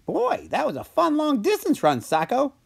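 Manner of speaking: very high cartoon sidekick voice; Slurpee. A narrator's reading. Slurpee catches up with Socko, high cartoon voice. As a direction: chatter in a high-pitched character voice. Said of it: "said Slurpee".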